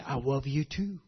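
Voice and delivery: soft, tender I love you too; father to toddler, tender and soft; tender reply. Retold or imitated. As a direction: answer the child gently. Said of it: "You say".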